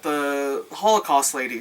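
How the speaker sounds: usual speaking voice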